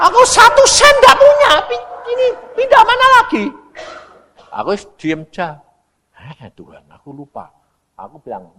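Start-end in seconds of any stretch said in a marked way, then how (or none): 0.1-3.4 very high, loud squealed prayer; shrieking mock desperation; panicked plea. Acts out panicked plea to God. 6.4-7.5 low, hushed confession to God; small, lowered mock-sheepish voice; sheepish admission. Sheepishly admits forgetting to God.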